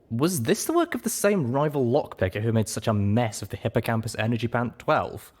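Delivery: usual speaking voice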